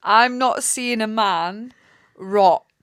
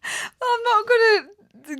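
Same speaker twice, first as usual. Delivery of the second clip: laughing; 13 semitones higher, much slower than usual. Real, not acted. Laughing, coy.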